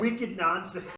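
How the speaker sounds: hammed-up Boston accent, wicked stretched out. Shown as a demonstration. Host jokes the verse should be read in a Boston accent, loud Boston accent. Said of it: "wants that read in a Boston accent".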